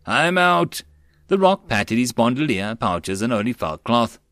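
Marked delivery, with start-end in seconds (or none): 0.1-0.8 high-pitched battle call; raised soldier's voice. A narrator's reading. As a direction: call it urgently to comrades.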